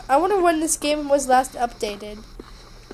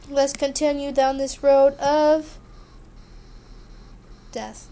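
suspenseful gap before a hushed DEATH; dramatic pause. Real, not acted. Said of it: "I had to pause there for dramatic".

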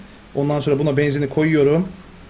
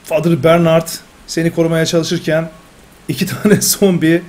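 louder, higher recap of the night's defense; dramatic results read; 3 semitones higher, 4 dB louder than usual. Acted. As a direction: read the outcome like a storyteller. Reads round results dramatically.

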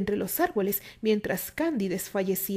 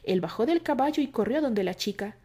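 plain reading voice

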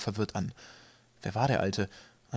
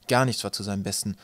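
plain reading voice

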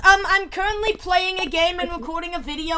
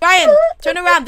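high, loud call to a friend; 12 semitones higher, 6 dB louder than usual. Real, not acted. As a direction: call out to get his attention. Calling to friend.

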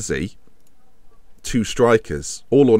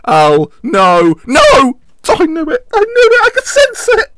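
high, loud cry of despair; anguished shout; 8 dB louder, slower than usual. Real, not acted. Streamer watching City score, anguished shout. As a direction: scream at the screen as the goal goes in. Rival scores late, he erupts.

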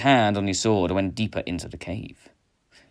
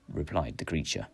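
plain reading voice

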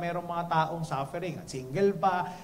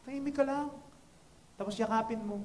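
ordinary delivery

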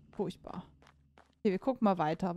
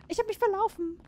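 much higher, playful squeak; squeaky high voice; 10 semitones higher than usual. Pretend. Gamer lost in the level, squeaking in a high voice. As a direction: squeak it like a lost little figure.

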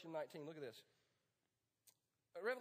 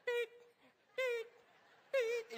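scanner beeps; 14 semitones higher, 6 dB louder, much slower than usual. Retold or imitated. Barcode scanner on the head.

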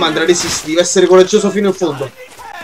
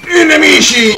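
calling out enemies; 7 semitones higher than usual. Real, not acted. Gamer spotting enemies mid-battle, calling out. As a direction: cry it out higher, drawing out the end.

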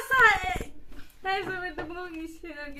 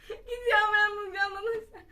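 laughing in pain; 3 semitones higher, 9 dB louder than usual. Real, not acted. Ate hot pepper.

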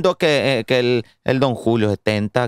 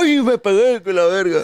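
high-pitched mock yelp from a viral clip; imitación de TikTok; 5 semitones higher than usual. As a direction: mimic a TikTok character crying out in a high voice. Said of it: "el de los TikTok". (imitando con voz aguda el quejido de un TikTok)

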